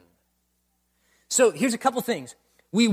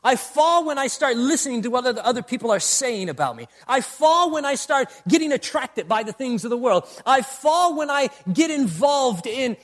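louder, insistent sermon cadence; preaching at full force; 5 semitones higher, 5 dB louder than usual. Acted. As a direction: hammer each I fall home.

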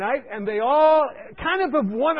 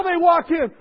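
ordinary delivery

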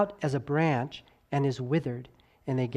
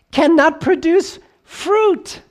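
"cannot produce fruit" very high, loud and slow; soaring emphasis; 11 semitones higher, 9 dB louder, much slower than usual. Shown as a demonstration. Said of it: "voice fluctuation ... to emphasize".

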